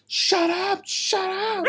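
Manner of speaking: very high-pitched imitation of annoyed girls; mock annoyed girls. The girls at the concert telling him off, high mock-annoyed voice. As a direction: squeal it like fed-up concertgoers. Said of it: "the chicks were like".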